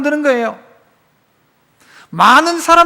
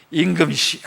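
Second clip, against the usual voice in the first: fawning courtier; 7 semitones lower, 6 dB quieter than usual. Retold or imitated. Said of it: "그때 이 도액이 쫙 등장합니다".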